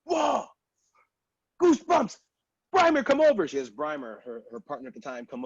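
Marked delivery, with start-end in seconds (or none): 0.0-3.5 high, loud imitated amazement; a woman stunned by his voice, much higher; excited. Retold coworker calls her partner over, amazed. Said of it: "And she goes".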